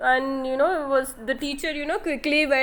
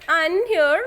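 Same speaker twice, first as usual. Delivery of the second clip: ordinary delivery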